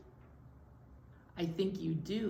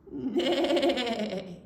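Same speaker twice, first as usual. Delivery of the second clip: long wavering whinny; horse neigh; 4 dB louder than usual. Acted. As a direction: neigh with a rapid wobble like a horse. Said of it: "Horse said".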